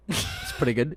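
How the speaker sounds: mock Irish accent, teasing; pretty good in a lilting Irish accent. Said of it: "It's getting worse and worse".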